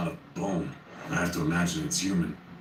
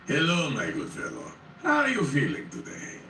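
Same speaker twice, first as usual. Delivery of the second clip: higher, silky-courteous plague doctor; polished, polite doctor; 7 semitones higher than usual. A narrator's reading. Plague doctor greeting the frightened narrator, polished and polite. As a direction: greet him like a too-courteous physician. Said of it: "his voice polished and polite".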